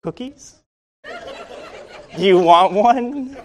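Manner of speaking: timid hopeful reply to the guard; sheepish. Retold or imitated. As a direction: offer the cookie like a kid caught out. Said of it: "I sheepishly replied".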